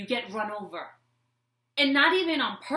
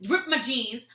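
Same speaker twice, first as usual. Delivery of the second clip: normal talking voice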